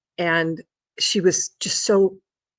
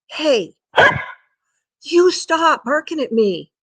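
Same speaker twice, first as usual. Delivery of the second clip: higher, louder, drawn-out telling-off; mild scolding; 10 semitones higher, 6 dB louder, slower than usual. Real, not acted. Owner telling the dog to stop barking, scolding. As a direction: scold the pet firmly, pitched up.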